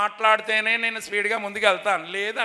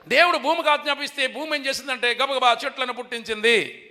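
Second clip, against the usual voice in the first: ordinary talk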